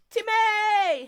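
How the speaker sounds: high, loud excited shout of a name; calling out. Real, not acted. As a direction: greet a viewer with a big high call.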